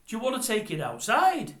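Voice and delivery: loud, high offer to fight; threatening challenge. Retold or imitated. Gary Stretch challenges the man to fight outside, loud and threatening. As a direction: square up to him. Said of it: "And Gary Stretch says".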